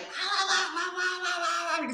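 high wailing wah-wah imitation of screaming; mock screaming. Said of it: "screaming at the voice".

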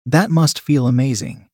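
plain reading voice

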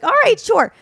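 high, loud chirpy agreement; mock eager yes. She accepts the drink, chirpy and loud. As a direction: squeal a fake-happy sure.

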